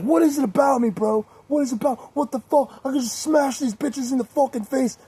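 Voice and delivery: high-pitched mock rant; mock-furious, high, aggrieved outburst; mad drunk friend. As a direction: imitate a drunk friend losing it, voice way up. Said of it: "he was so mad".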